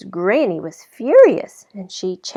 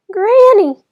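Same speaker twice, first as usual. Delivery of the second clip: high, loud startled cry; shocked little girl cries out; surprised exclamation; 13 semitones higher, 13 dB louder than usual. A narrator's reading.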